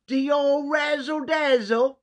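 very high, loud and slow hammed-up British accent; bad British accent. Boasting on a baking show in high mock British accent. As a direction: squeal it out in an awful British accent.

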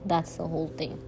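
usual speaking voice

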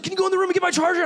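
wife's rushed request. Retold or imitated. Preacher imitating wife Brooklyn begging for her charger, high and fast. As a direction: rattle it off in a high whine. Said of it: "She's like".

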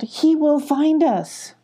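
high, slow, drawn-out call. A narrator's reading. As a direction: call it out high, stretching the words. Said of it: "Molly called".

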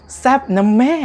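mock sultry gush. Judge's gushing reaction to sexy show.

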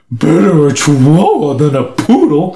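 put-on dog character voice; talking dog. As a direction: answer as a sassy talking dog. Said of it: "Yeah, and he's like".

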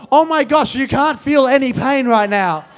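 high, loud amazed echo; retold freaking out. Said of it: "I'm freaking out".

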